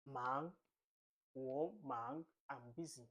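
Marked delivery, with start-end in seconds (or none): 0.1-0.5 Mandarin second tone on MANG, drawn out upward. Shown as a demonstration. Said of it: "this is the second tone". 1.3-2.2 WO MANG with MANG rising; second tone. Shown as a demonstration. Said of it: "this is the second tone".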